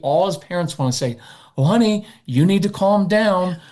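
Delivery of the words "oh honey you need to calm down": sugary, talking-down calm-down with a laugh; condescending. Shown as a demonstration. Parent demonstrating a condescending calm-down. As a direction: patronize them sweetly. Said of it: "It sounds kinda s-, condescending and patronizing".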